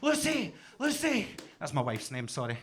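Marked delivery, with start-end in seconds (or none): 0.0-1.3 panicked shout. Retold or imitated. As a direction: call out in alarm. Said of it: "I was like".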